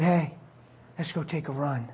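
soft, low and close; intimate, hushed invitation. Retold or imitated. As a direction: murmur a gentle invitation. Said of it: "It's so personal and intimate".

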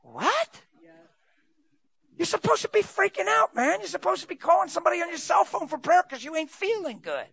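high, squeaky, confused imp voice; baffled little imp. Acted. The imp protests to the praying believer, high and baffled. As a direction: sound stunned that the plan failed. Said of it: "that little imp is going".

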